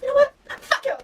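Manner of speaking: high-pitched, hammed-up defiance; drunken new confidence. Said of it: "get this new sense of confidence".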